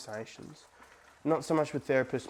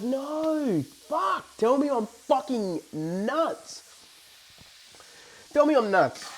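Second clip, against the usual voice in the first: pleading disbelief; 7 semitones higher, slower than usual. Retold or imitated. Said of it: "And I'm like".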